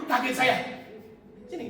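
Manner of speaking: ordinary talk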